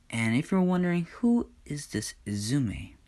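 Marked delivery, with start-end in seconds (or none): none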